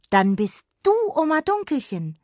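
high, loud sudden realisation; blurted out. A narrator's reading. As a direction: burst out in surprise. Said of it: "entfuhr es Jonna".

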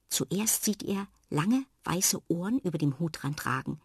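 ordinary delivery, nothing marked

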